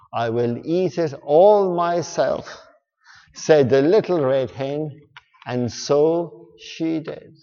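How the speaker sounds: lively storybook read-aloud; reading to children. Acted. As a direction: read it like a bedtime story to kids.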